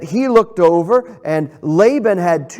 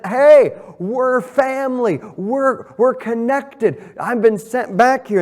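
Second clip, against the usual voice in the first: higher, eager greeting to family; excited Jacob; 7 semitones higher than usual. Acted. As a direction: burst out with happy excitement. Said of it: "He's so excited".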